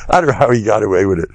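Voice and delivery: ordinary talk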